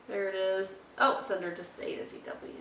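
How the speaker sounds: ordinary talk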